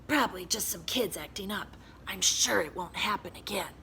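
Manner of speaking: unvoiced scratchy whisper. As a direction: hiss it innocently. Swamp's voice.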